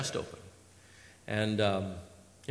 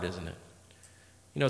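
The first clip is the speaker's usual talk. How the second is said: ordinary talk, nothing marked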